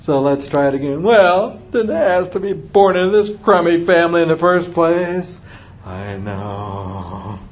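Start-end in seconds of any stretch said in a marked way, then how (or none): 1.0-5.4 put-on raised, whiny smart-mouth kid comeback; mock teenage sarcasm, pitched up; sarcastic kid. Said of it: "the sarcasm starts to ooze out". 5.8-7.4 low, soft, drawn-out "I know"; hushed, unruffled parent reply; softer. Shown as a demonstration. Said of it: "should it get louder or softer? Softer".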